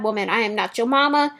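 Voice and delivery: ordinary talk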